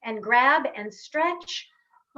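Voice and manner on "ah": long open "ah" sigh. Shown as a demonstration. Coach stretching the other side, long sigh. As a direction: let the breath out on a drawn-out vowel. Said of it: "and sigh out".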